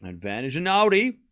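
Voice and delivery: quick running rally commentary; live play-by-play. Acted. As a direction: call each shot as it happens.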